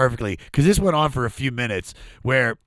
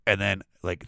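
ordinary talk

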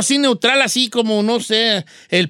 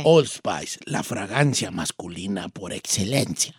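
very low, much softer cologne-ad voice; sexy ad voice; 8 dB quieter, slower than usual. Shown as a demonstration. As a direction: croon the slogan low and intimate. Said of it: "vas a decir con tu voz sexy".

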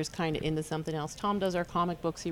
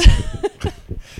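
big loud laugh, high; loud burst of laughter; 13 dB louder than usual. Real, not acted. Laughing about upset customers.

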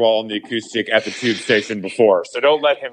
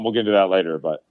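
ordinary talk, nothing marked

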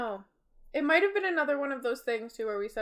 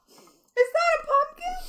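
very high, slow, squeaky question; very high squeaky quote; 8 dB louder, slower than usual. Retold or imitated. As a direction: squeak it like a child. Imitating the Vine again.

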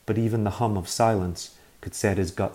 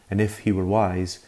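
plain reading voice

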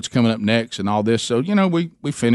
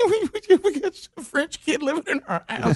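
high, squeaky-pitched helpless laughter; laughing to tears. Retold or imitated. Wife cracking up over French kid, laughing to tears. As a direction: imitate laughing too hard to talk. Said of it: "we just get to laughing and tears".

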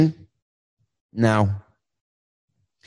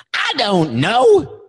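shouting; 7 semitones higher, much slower than usual. Acted. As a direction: yell it out slowly. Caller shouts.

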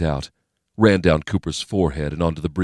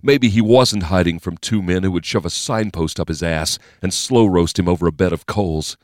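plain reading voice

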